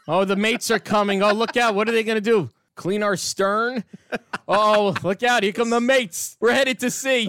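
high-pitched pretend panic; mock fear. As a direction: raise a hammed-up alarm, playing scared for laughs. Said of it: "are you afraid of the mates?".

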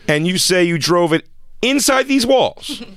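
high, slow questioning; hammed-up dim valet checking details; mock interrogator. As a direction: draw out each absurd question. Co-host plays dim interrogating valet.

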